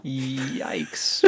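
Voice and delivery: breathy whispered yikes; a hushed, grossed-out yikes. Real, not acted. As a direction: whisper it in mock disgust. Reacting to horse pee.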